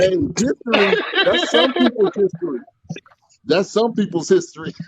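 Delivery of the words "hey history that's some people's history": chuckling the words out; giggling as he talks; laughing. Real, not acted. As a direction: laugh through the line, amused. Laughing at a joke about age.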